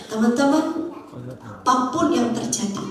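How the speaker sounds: ordinary talk, nothing marked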